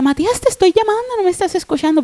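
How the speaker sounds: high-pitched insistent call; retold loud calling. Mother calling her unresponsive son from the bedroom, retold insistent calling. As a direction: call out, pitched well up, as if to another room. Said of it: "porque le hablaba fuerte".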